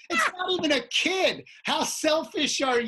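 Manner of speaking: high, loud mock outrage; indignant scolding. Performer scolds lady pushing an adult, indignant. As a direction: tell off a selfish heckler.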